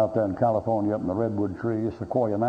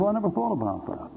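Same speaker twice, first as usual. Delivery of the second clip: higher, sheepish admission; mock dumbfounded reply; 8 semitones higher than usual. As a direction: play the caught-out pastor. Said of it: "He said".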